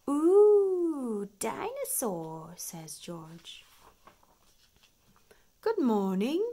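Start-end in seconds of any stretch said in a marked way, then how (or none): 0.1-2.5 high, loud gasp of wonder; little boy marvelling at a toy; amazed. A narrator's reading. George sees the big dinosaur, awed and delighted. 5.6-6.4 loud cheerful shopkeeper welcome; beaming greeting. A narrator's reading. Mister Fox welcomes the family, beaming. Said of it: "beams Mister Fox".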